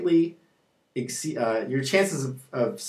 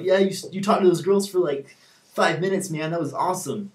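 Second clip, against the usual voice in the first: higher, hyped-up buddy voice; mock excited congratulation; 5 semitones higher than usual. Friend congratulating pal on talking to girls, mock excited. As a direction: imitate a friend pumped for his pal.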